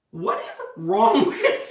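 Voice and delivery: slow, drawn-out mock exasperation breaking into laughter; laughing mock outburst; exasperated through laughter. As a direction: imitate a fed-up husband and crack up. Exasperated husband retold, laughing.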